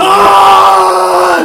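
huge, long, high-pitched held yell; mock scream. Podcast host cursing the film's editor, mock scream. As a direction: bellow the name in hammed-up fury.

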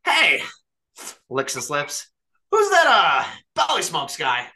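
put-on leer at the boy like a creepy dad; creepy dad impression. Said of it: "his dad goes".

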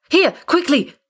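high, urgent call; shouted. A narrator's reading. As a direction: shout for help to come at once. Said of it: "he shouted".

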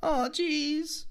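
very high, squeaky cartoon voice; cartoon character impression. Host trying one of the actor's voices, high cartoon impression. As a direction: put on a squeaky flustered cartoon kid. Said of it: "which one of his voices would be".

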